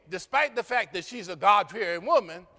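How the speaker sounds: ordinary talk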